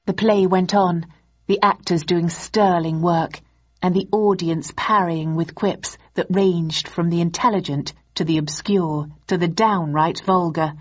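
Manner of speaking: ordinary delivery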